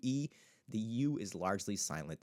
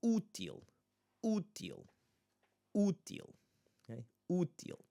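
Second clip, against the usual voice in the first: útil with a high, stressed 'Ú', then dropping; first-syllable stress; 8 semitones higher than usual. Shown as a demonstration. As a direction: punch the first syllable of 'útil'. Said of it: "accentuated syllable at the first one".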